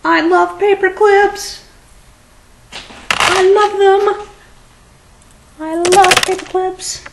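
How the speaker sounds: very high, loud put-on squeal; gushing squeal. Vlogger gushing over paper clips, high mock squeal. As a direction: squeal your love in a tiny piercing voice.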